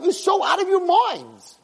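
high, slow, drawn-out disbelief; incredulous exclamation. Retold or imitated. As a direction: exclaim it as a retold reaction, utterly amazed. Retold disbelief at the promise.

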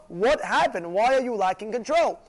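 ordinary talk

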